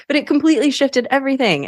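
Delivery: high, quick, giggly delivery; laughing. Real, not acted. Getting back to the point after the joke, laughing. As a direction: push the words out through laughter. Talks on through her laughter.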